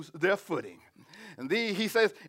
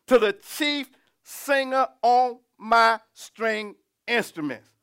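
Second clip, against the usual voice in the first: drawn-out quotation; slower than usual. Retold or imitated. Preacher showing how the prophet closed his prayer, drawn-out quotation. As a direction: stretch each word out slowly. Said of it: "That's how he say".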